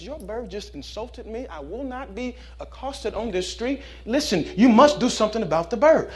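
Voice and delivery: indignant complaint. Acted. Man complains to owner.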